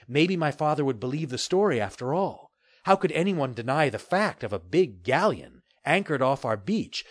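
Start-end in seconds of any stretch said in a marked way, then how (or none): none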